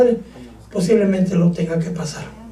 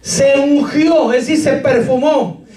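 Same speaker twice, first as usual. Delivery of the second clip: raised, slow solemn Bible reading; scripture reading; 7 semitones higher, slower than usual. Acted. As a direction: read the verse aloud with gravity. Preacher reads scripture aloud.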